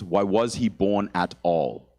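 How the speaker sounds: very slow, dry deadpan; slow flat paternal burn; father's deadpan. Retold or imitated. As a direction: let the insult land slowly. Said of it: "clearly the father is".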